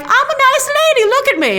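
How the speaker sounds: high, sugary mock innocence; sweet old lady. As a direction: sell yourself high and sweet as a harmless lady. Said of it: "first she's like".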